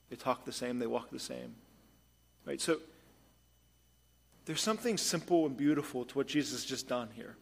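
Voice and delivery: usual speaking voice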